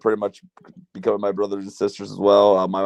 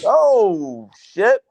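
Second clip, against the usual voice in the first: high-pitched mock shock; retold startled dismay; 10 semitones higher, 4 dB louder than usual. Man recalls opening the wrong car door, startled dismay. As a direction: act out a startled realization, pitched way up.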